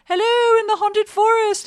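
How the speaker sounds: high, loud, slow call across the river. Retold or imitated. Yelling to the haunted forest crew across the river, high loud call. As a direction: shout it over to the far bank. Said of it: "We'd yell back and forth".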